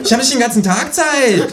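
high, fast, loud demand; kangaroo voice, bossy. A narrator's reading. Känguru bosses the policeman, high and fast. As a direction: order him around in the high kangaroo voice.

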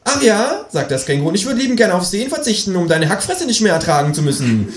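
high, fast kangaroo insult; snippy kangaroo. Acted. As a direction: fire it off high and cheeky.

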